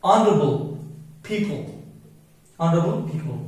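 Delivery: "honorable" said "onorable", no h sound; "honorable" spoken as on-uh-ruh-bull; silent h in honorable. Shown as a demonstration. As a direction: drop the h in "honorable", slow and careful. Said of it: "H remains silent".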